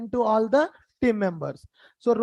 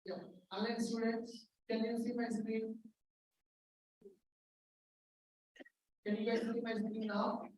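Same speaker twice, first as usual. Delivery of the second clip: very faint, far from the mic; faint voice through a weak microphone; barely audible; 17 dB quieter than usual. Real, not acted. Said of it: "Sir, your voice is very low".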